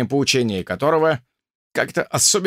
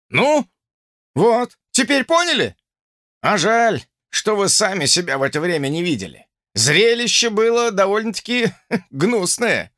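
raised, scornful officer voice; mocking drill sergeant; 8 semitones higher than usual. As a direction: scoff at the cadet's slouch. Officer mocking cadet's posture.

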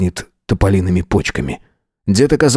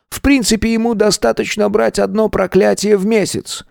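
grandfather voice; 7 semitones higher than usual. Acted.

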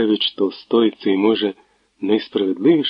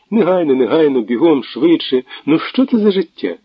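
higher, louder lively woman's voice; chatty young woman; 4 semitones higher, 3 dB louder than usual. Acted. Natalia chatters merrily to the gloomy prosecutor.

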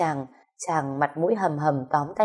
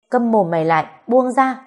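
louder command rising in pitch; angry order; 6 semitones higher, 5 dB louder than usual. A narrator's reading. Woman struggling free of his grip, angry order. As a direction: order him off with a raised voice.

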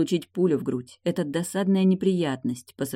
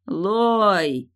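louder, higher, drawn-out name; calling out; 5 semitones higher, 5 dB louder than usual. A narrator's reading. Husband hollering for his wife from his bed. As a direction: holler the name in one long arc.

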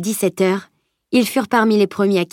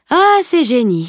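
lent, plus haut et plus fort, agacé; exaspération amusée; 5 semitones higher, 4 dB louder, slower than usual. Acted. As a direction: soupirer contre les génies en levant les yeux au ciel. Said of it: "Salim leva les yeux au ciel". (exaspération lente et appuyée)

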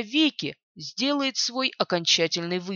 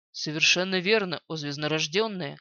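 low servile necromancer; 5 semitones lower, 3 dB quieter than usual. Acted. Necromancer bows to mistress.

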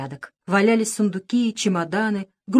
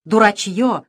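loud furious "Дурачьё!"; queen yelling at her bumbling pirates, loud; angry shout; 3 semitones higher, 7 dB louder, slower than usual. A narrator's reading. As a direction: yell it at the fools. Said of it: "закричала королева".

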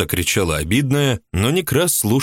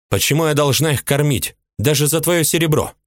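higher, indignant shriek of a jealous woman; 7 semitones higher than usual. A narrator's reading. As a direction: squeal it out in outrage. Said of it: "взвизгнула Улыба".

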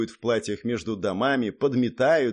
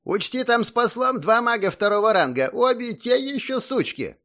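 much higher, worried character voice; concerned Zak; 11 semitones higher than usual. A narrator's reading. Zak warning the hero about two mages, concerned. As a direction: warn with a touch of worry, pitched up. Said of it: "обеспокоенно произнёс Зак".

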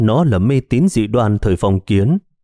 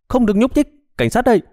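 much higher commanding yell; police shout; 13 semitones higher, faster than usual. A narrator's reading. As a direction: order them to freeze. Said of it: "lao ra hét lớn". (hét lớn ra lệnh của cảnh sát)

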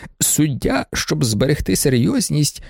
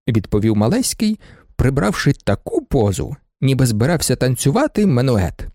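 ordinary delivery, nothing marked

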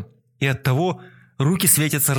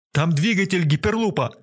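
shouting; 5 semitones higher than usual. A narrator's reading. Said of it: "крикнул мистер Пирсинг".

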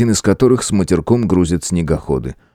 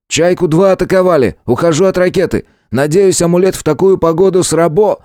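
over walkie talkie; 10 semitones higher than usual. Acted. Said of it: "рация захрипела помехами, донёсся спокойный голос".

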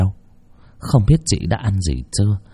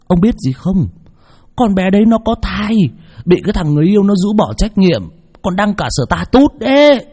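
put-on gossiping old man; 11 semitones higher, 3 dB louder than usual. Old man gossiping over food.

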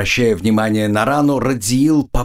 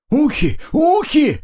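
cry of pain; slower than usual. A narrator's reading. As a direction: wail it high as a hurt orc. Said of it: "кричал он". (вопить от боли очень высоким голосом)

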